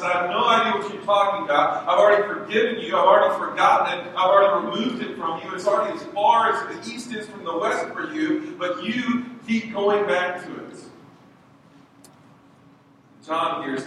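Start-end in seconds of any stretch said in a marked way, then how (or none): 0.0-10.8 loud, high, fast voice of God brushing the sin aside; God answering a praying believer, loud and emphatic. Acted. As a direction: boom out forgiveness as God, insistent.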